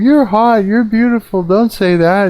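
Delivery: hammed-up high fawning voice; mocking fawning guys. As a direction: parody thirsty men gushing. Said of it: "all these guys are, are responding".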